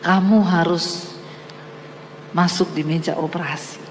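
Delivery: God gently telling her she must have the surgery. Acted. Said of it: "Tiba-tiba Tuhan ngomong sama saya".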